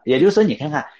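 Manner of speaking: normal talking voice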